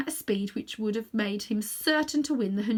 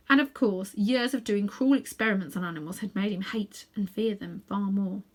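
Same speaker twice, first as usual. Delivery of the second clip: usual speaking voice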